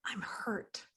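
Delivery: voiceless whisper, very soft; whispered confession. Retold or imitated. Client finally admitting the hurt, whispered. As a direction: breathe it out like a hidden admission. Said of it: "they say".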